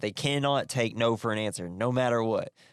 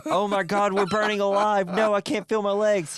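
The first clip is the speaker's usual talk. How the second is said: hammed-up screaming; 10 semitones higher, 5 dB louder than usual. Burning car scene.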